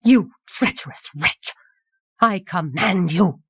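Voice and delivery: fierce accusation without real shouting; condemn, then command with contained fury; terrible angry voice. A narrator's reading. Anagel accuses the young king of treachery, angry command. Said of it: "she shouted in a terrible voice".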